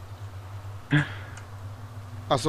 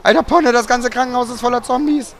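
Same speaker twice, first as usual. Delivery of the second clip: higher, louder alarmed call; excited shout; 7 semitones higher, 5 dB louder than usual. Real, not acted. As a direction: blurt it out in alarm. Spots zombie-filled hospital.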